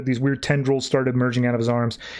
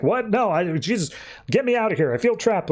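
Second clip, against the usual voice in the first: high-pitched mock panic; retold panic, pitched high without shouting; imitated freak-out; 7 semitones higher than usual. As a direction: act out someone trapped and freaking out. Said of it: "Eddie starts freaking out".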